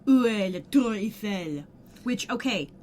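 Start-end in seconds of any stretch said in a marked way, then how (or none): 0.1-1.6 put-on deliberately clumsy French accent, a bit louder; bad French accent. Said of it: "says with a very bad accent".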